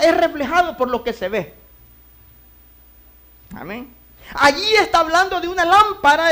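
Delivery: loud, high preaching cry. Acted. Preacher calling out to the congregation, loud. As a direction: preach it out to the church.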